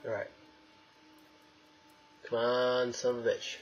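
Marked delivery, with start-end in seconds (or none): none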